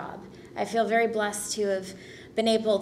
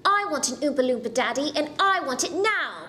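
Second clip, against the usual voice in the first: put-on bratty British child's demand, higher pitch; Veruca; 5 semitones higher than usual. Actress showing off an accent for the interviewer, bratty demand. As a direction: whine it in an English accent, rising to an insistent NOW. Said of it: "Veruca from Willy Wonka".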